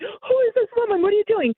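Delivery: high-pitched mock accusation, fake jealousy; faked jealous outrage. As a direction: pretend to be a shocked, suspicious girlfriend. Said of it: "I'm faking it".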